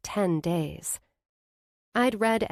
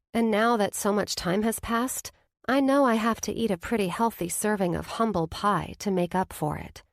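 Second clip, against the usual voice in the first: plain reading voice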